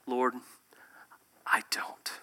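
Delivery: hushed, small admission; whispered confession. Acted. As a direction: barely breathe the words out. Honest prayer, whispered.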